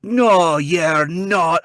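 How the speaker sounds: loud, high Scottish contradiction; Scottish mom. Retold or imitated. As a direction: flatly refuse to believe him, in Scots. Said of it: "cute little Scottish lady".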